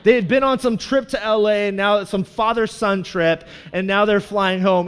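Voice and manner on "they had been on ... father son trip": ordinary talk, nothing marked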